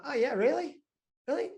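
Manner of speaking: high-pitched, doubting retold reply; sceptical comeback. Investor answering the CFO's reassurance, sceptical. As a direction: play back a sceptical "oh really". Retold pointed challenge to the CFO.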